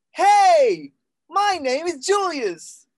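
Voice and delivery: high, loud, drawn-out calling voice; mixed voice. Shown as a demonstration. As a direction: call it out high, between chest and head. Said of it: "mixed voice".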